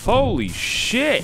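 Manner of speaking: high, drawn-out cry of shock; startled exclamation. Real, not acted. Player startled by something scary in the game, startled exclamation. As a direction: let it shoot up high and stretch it out.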